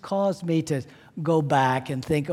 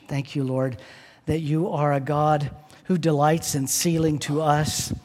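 ordinary talk, nothing marked

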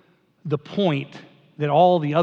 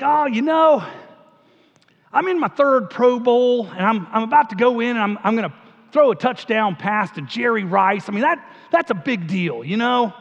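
put-on higher, louder aw-shucks Southern accent; folksy Southern accent, pitched up and loud; aw shucks Southern Peyton Manning; 5 semitones higher, 3 dB louder than usual. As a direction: play the humble Southern quarterback in a Southern accent. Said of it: "sort of talks like this".

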